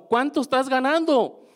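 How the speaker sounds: retold excited asking; eager question. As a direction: ask her eagerly.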